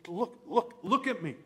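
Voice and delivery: put-on exasperated mom grabbing her boy, ending in a loud demand; scolding mother. Mary confronting her son at the temple, building to a loud scold. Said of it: "she says".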